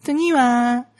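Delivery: 軽く真似た可愛いアナウンス声; ゆっくり甘い萌え声を少し真似る; 萌え声. Retold or imitated. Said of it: "めっちゃ萌え声の車掌さん". (萌え声の車掌の軽い真似)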